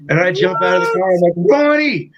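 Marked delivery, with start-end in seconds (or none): 1.4-2.1 calling out. Retold or imitated. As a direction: shout his name in relief. Calling out to bandmate at cops.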